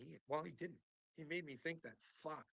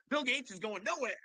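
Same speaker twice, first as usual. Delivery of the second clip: mocking impersonation; 10 semitones higher, 9 dB louder than usual. Mock voice of Uncle Will.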